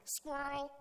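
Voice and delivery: Adam blurting out the name for a squirrel, high and loud; blurted name. Pretend. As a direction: blurt it out like a distracted kid. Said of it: "he was just like".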